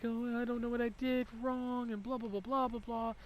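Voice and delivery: high-pitched imitation of a tearful wail; mock crying. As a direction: whine it like a sobbing teacher, mockingly. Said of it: "that she's crying to 'em".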